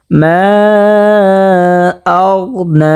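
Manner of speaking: slow recitation with মা stretched out; three-count elongation. Shown as a demonstration. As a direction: hold the vowel of মা very long before আগনা. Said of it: "এখানে তিন আলিফ".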